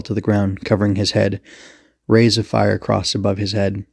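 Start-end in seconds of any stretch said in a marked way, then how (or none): none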